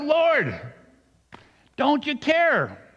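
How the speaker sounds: high, drawn-out cry to the Lord; shouted plea. A narrator's reading. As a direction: yell it slowly up at a sleeping master. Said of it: "They shouted".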